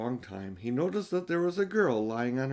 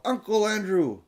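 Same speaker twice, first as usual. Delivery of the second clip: higher, louder cry of the name; boy remembering, shouting out higher; 9 semitones higher, 6 dB louder than usual. Acted. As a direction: shout it the moment you remember. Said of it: "and he shouted out".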